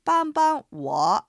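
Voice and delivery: 帮帮我 said very slowly, each syllable clear, 帮 level then light, 我 dipping; slow Chinese demo. Shown as a demonstration. Teacher modelling 帮帮我 for Japanese listeners, slow and clear. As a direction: spell out 帮帮我 slowly for learners. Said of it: "繰り返して聞いてみましょう".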